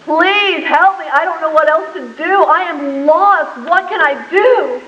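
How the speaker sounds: high, drawn-out desperate plea; hammed-up wail for help from above; tearful pleading, retold. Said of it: "I fall to the ground, crying".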